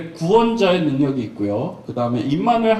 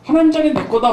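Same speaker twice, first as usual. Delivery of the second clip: louder, rising brag on 하나님; boastful Peter; 8 semitones higher, 4 dB louder than usual. Retold or imitated. Peter bragging to everyone, crowing 하나님 higher. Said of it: "얼마나 잘난 척하고".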